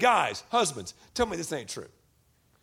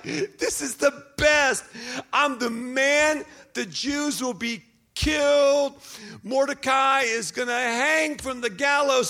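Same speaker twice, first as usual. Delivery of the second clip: high, slow, drawn-out gloating; hammed-up villain's elation, pitched up; Haman, so excited; 6 semitones higher, slower than usual. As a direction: crow it in triumph, stretching the big words. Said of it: "Haman is so excited".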